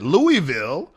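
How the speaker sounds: Louisville as three full syllables, LOO-ee-VIL; non-local pronunciation. Shown as a demonstration. Showing the hosts the wrong way, Louisville as full LOO-ee-vil. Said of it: "that's not the real way to say it".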